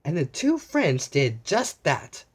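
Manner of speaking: usual speaking voice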